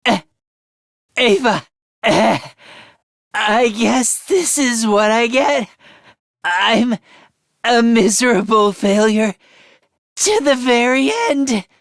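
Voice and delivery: halting and spent, with sighs; weak last words. Acted. Telling everyone not to blame Ava, weakly faltering. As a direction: drag out each phrase as if strength is failing.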